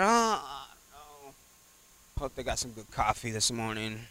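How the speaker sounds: soft, mumbled, half-hearted; mock bored churchgoer. Mocking lazy churchgoer, soft and bored. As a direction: sound like you'd rather have coffee.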